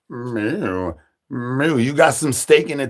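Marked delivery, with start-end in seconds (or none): 0.1-0.9 lower, softer cow moo; a lightly voiced bull mooing; mock cow moo. Bull answers the call.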